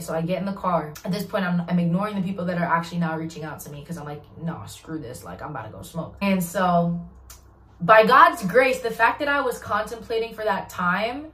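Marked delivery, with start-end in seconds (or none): 4.4-6.2 quiet mutter. Retold or imitated. Muttered thought, giving up.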